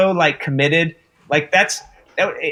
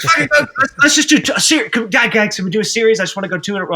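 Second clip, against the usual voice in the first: eager rush; 6 semitones higher, faster than usual. Retold or imitated. Actor eagerly urging the crew to shoot again. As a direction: hurry everyone along, keen to go. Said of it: "He'd be like".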